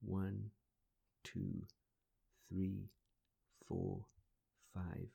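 pace the breaths gently, counting slowly. Acted.

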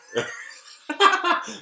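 high, loud peal of laughter; burst of laughter. Real, not acted. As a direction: crack up loudly. (högt, ljust skratt)

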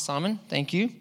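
ordinary talk

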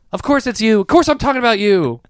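high, flustered reassurance. Retold or imitated. Host covering a botched name to the comic, high and flustered. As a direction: cover the gaffe in a rush. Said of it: "I'm like".

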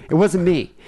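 put-on furious girlfriend denial. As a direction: imitate an angry, quick denial. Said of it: "She was just furious".

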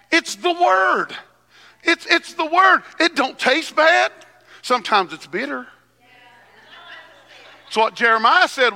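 high, loud pulpit preaching; preaching at full force. Acted. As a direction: preach it out to the congregation.

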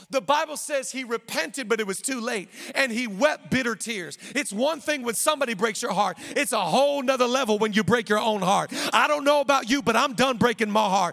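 loud, driving sermon delivery; full-force preaching. Acted. Pastor preaching to the church, full force. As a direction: preach it hard to the congregation.

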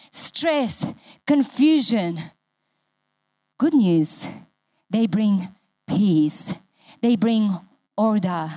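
slow, deliberate sermon cadence with long falls; measured preaching. Acted. Preacher comforting the church with good news, slow and weighty. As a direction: lean on each phrase and let it land.

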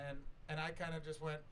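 ordinary talk, nothing marked

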